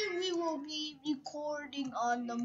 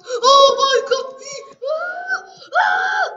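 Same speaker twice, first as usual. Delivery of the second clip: high, loud scream of fright; panicked scream; 11 semitones higher, 12 dB louder than usual. Real, not acted.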